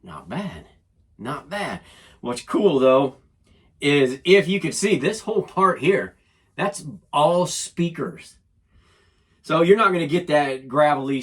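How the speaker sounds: usual speaking voice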